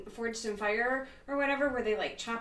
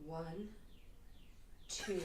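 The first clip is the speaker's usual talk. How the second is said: sultry voice; 13 dB quieter than usual. Acted. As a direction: count it out seductively. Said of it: "It's freaking awesome".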